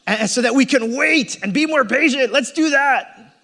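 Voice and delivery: hammed-up self-help preacher; mock pep-talk preacher. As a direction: hype the crowd with hollow willpower. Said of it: "I can come and say".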